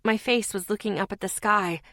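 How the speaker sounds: usual speaking voice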